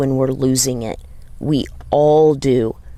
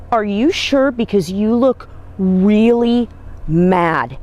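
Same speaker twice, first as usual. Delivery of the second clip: higher, slow, probing are you sure; concerned questioning; 6 semitones higher, slower than usual. Retold or imitated. To the upset young rider, slow concerned doubt. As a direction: gently press her, doubtful and caring. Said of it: "like this".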